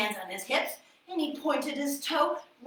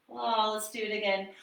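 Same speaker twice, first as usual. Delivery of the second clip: normal talking voice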